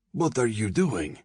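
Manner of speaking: a touch of panic in the question; alarmed man. A narrator's reading. Frightened man confronts knife-wielding girl.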